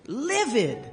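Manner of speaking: "livid." hit high and loud, falling; emphatic repeat. Real, not acted. Telling the family how angry Gordon was, emphatic.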